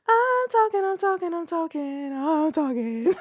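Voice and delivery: playful high descending babble; high-pitched mic test. Shown as a demonstration. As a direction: start very high and slide down through the repeats. Testing vocal calibration with repeated phrase.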